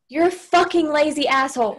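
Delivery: angry insult. Retold or imitated. Demo of insulting a partner.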